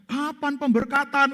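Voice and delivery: high, slow prying question; drawn-out nosy question from the pastor's wife; mock pastor's wife. Pastor's wife asks about wedding. (ibu gembala bertanya dengan nada tinggi dan lambat)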